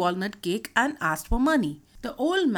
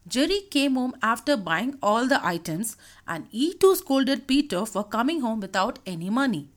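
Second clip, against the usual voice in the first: plain reading voice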